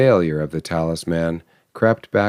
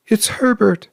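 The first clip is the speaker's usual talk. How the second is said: scream. A narrator's reading. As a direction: shriek it with wild hope. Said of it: "she screamed".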